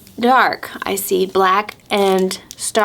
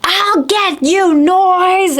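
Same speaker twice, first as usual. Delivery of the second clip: slow, high, mock-angry threat; angry owl; 7 semitones higher, much slower than usual. A narrator's reading. Storyteller voices Owl threatening the noise, mock anger. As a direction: draw out an angry vow in a higher pitch. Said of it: "he is angry".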